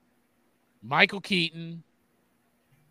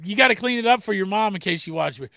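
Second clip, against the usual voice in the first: ordinary talk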